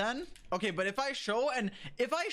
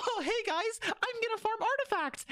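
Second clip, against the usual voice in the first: higher, chirpy hammed-up streamer intro; mock streamer voice. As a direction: parody a cheerful opener. Mocking his own stream opener.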